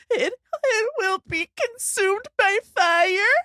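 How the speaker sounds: high, slow, sobbing wail. Retold or imitated. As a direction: wail it through tears. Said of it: "sobbing and, like, gasping".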